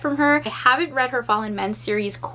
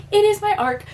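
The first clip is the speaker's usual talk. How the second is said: emphatic excited reveal; 10 semitones higher than usual. Retold or imitated. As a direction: announce it again in high-pitched delight.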